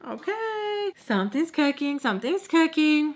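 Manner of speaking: high, drawn-out singsong; sing-song it like you smell trouble; knowing suspicion. Retold or imitated. Nanny noticing the couple's secret talk, suspicious singsong. Nanny privately suspecting something.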